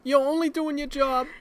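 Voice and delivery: very high put-on child voice; a tiny kid forgiving the nurse; imitating child. Boy reassuring Mike, imitated child voice. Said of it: "He's like".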